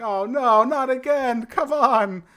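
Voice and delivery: high, loud whiny groan of a man; exasperated Ash. Retold or imitated. Ash dragged into another dimension, high whiny exasperation. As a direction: whine it out like he can't believe it's happening again. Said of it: "It's like".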